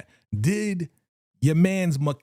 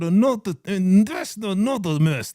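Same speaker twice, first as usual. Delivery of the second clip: Kanan; 4 semitones higher than usual. Shown as a demonstration. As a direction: read it through teeth that will not move. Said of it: "I can't do it like this".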